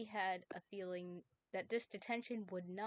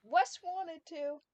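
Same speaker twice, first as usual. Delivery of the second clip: much higher, louder, slow defensive plea; defensive protest; 9 semitones higher, 9 dB louder, much slower than usual. Acted. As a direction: defend yourself, pushing it high. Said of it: "Danny defended".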